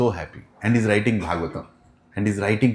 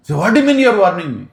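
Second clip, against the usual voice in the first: fast, high, loud retort; heated high-pitched comeback; indignant challenge; 7 semitones higher, 5 dB louder, much faster than usual. Acted. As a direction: fire back rapidly in outrage. Gopi angrily challenges the child.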